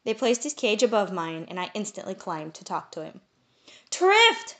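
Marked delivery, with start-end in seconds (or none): 3.9-4.6 loud, high call across the room; shout. A narrator's reading. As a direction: shout his name in joyful recognition. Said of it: "I shouted".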